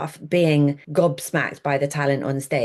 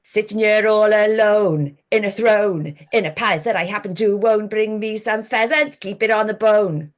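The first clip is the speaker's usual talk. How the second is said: put-on lilting Welsh accent, sung-spoken lines; half-sing it in a Welsh voice; 4 semitones higher than usual. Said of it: "Give us a verse then".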